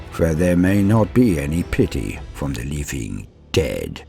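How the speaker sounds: ordinary talk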